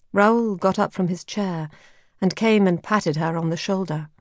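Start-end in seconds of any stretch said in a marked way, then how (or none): none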